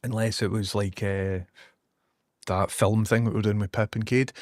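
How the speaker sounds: usual speaking voice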